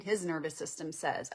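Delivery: normal talking voice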